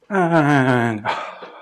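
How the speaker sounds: loud drawn-out "and", then a breathy sigh; satisfying sigh out. Shown as a demonstration. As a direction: release the whole breath in an audible sigh. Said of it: "satisfying sigh out".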